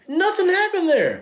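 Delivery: very high-pitched incredulous protest; exasperated. Real, not acted. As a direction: let the voice leap up in disbelief. Ranting about a pointless scene.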